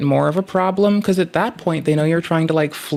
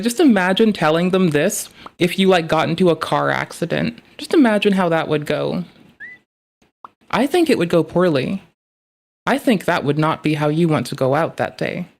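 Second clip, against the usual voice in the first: ordinary delivery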